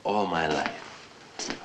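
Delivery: low, slow, soft trail-off; subdued, drawn-out reflection; quiet rueful musing. Acted. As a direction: let the words sink away slowly. Drunk writer reflects alone, restarts.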